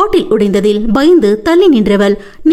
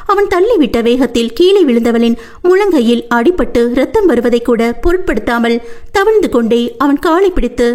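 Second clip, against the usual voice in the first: ordinary delivery, nothing marked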